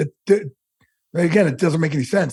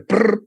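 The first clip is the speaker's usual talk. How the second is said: imitated heart flutter; 4 semitones higher than usual. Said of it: "my heart, it's like".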